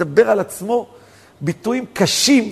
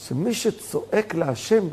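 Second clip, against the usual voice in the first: slow, softer "שמי שצועק להשם"; measured statement; 6 dB quieter, slower than usual. Real, not acted. Preacher explaining the commentary's principle, slow and soft. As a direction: hold it back, softly and slowly.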